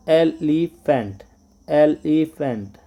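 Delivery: very slow, drawn-out elephant; say aloud. Shown as a demonstration. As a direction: stretch out each syllable of elephant. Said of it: "allowed जोर से इसको बोलना है".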